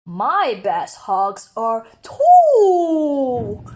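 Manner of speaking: slow, loud whiny bear cub; drawn-out longing bear cub; little bear voice. Acted. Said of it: "thought Douglas".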